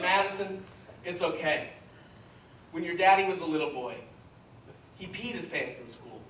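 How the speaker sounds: low, soft confiding voice; hushed, tender fatherly tone, acted; gentle reassurance. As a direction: comfort a small child quietly. Consoling daughter, pulled aside.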